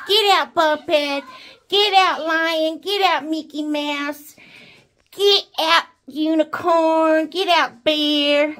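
loud, firm pretend eviction; mock ordering out. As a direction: order each one out loudly, one by one. Acts out Hannah ordering toys out.

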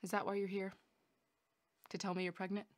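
soft, subdued questioning; quietly stunned. Acted. Learning the visitor is pregnant, quietly stunned.

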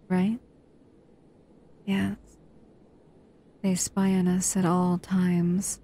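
gentle intimate roleplay voice, close to the mic. Acted.

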